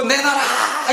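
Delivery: put-on high, drawn-out toddler demand; mock child tantrum. Preacher imitating a child demanding a toy on the ground, mock tantrum. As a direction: wail it like a child thrown on the floor. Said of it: "가다가 뒤집어져가지고".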